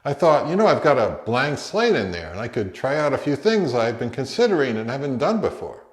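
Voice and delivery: ordinary talk, nothing marked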